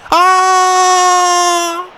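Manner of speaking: long high triumphant yell; shout of joy. Real, not acted. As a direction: let out a big cry as the penalty goes in. Penalty kick reaction.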